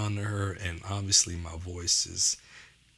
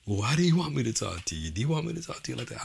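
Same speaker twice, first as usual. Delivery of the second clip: much higher, fast stern retort; 5 semitones higher, faster than usual. Retold or imitated. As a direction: throw the question back harshly. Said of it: "I still wasn't getting it".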